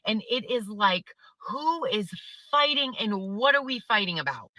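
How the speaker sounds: ordinary delivery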